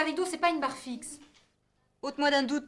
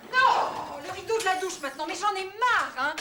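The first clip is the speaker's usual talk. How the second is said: exasperated; 7 semitones higher, 7 dB louder, slower than usual. Acted. Fed up with the roommate.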